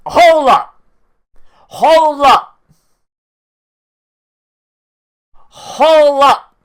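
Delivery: loud, high, repeated exclamation; startled shout. Real, not acted. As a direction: shout it to stop everything. Startled objection while watching the show.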